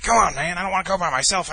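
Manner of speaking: pleading. Retold or imitated. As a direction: mimic a roommate begging for company. Retold roommate begging for company.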